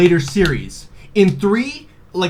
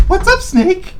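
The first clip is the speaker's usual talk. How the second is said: mock Mei Ling; 4 dB louder, slower than usual. Voicing Mei Ling on codec.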